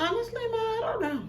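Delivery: high, small sheepish voice; sheepish kid. Retold or imitated. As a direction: shrug it off like a caught kid. Sheepish answer to mom.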